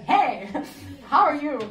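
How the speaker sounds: loud greeting broken by a laugh; laughing hello. Retold or imitated. Retelling the cheeky hello to the swami, laughing. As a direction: blurt it out and crack up midway.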